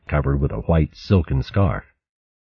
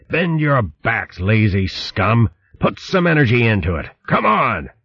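high, driving slave-driver yell; barking orders; 6 semitones higher than usual. Acted. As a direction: whip the rowers on with your voice. Slave master drives rowers.

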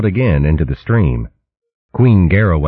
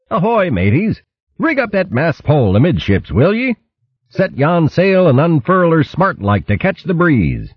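higher, hammed-up nautical orders; mock sea captain; 12 semitones higher than usual. A narrator's reading. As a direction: bark it like a pirate captain. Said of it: "calling out orders".

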